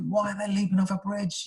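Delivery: high-pitched, baffled question; exaggerated disbelief; mock incredulity. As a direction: play the startled reader, pitch jumping up. Voices a puzzled reader's reaction.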